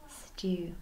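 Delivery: breathy whisper on stew. Shown as a demonstration. Teacher whispering the title to the class. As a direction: whisper the word stew like a secret.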